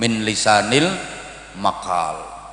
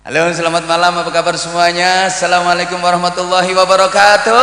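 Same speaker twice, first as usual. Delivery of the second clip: high, loud stage greeting building to the end; pop singer's greeting; 5 semitones higher, 5 dB louder than usual. Shown as a demonstration. Singer greeting a concert audience, high loud showy shout. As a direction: hype the crowd, rising to a stretched loud finish. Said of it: "pakai gayanya penyanyi".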